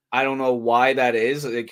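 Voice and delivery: normal talking voice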